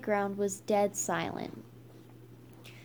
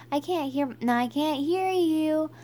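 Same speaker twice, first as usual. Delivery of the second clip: cheer call; 6 semitones higher than usual. A narrator's reading. Skunk goads the underdogs mid-cheer, raised rallying call.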